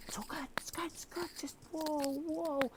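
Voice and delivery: hushed, breathy whisper from the back; soothing whisper. Retold or imitated. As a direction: whisper calm encouragement across the room. Calming speaker from back of hall.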